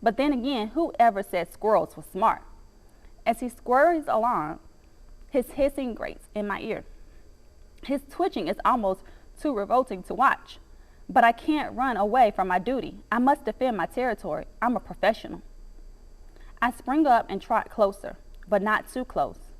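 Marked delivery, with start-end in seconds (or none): none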